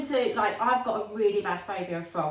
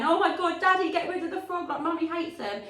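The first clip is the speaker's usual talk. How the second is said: panicked plea; 8 semitones higher than usual. Retold or imitated. Mum panicking at dad about the frog, high frightened cry. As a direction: squeal it out like the frog is right there.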